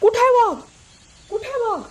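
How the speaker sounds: high-pitched urgent questions; urgent calling out. Acted. Villagers running back about the tiger, urgent high calls. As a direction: villagers crying out as they search.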